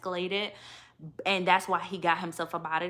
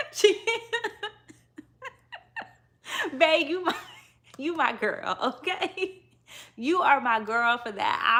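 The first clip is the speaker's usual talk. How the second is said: laughing cheer; 8 semitones higher than usual. Real, not acted. Reacting to the man leaving, laughing and cheering. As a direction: cheer him on through laughter.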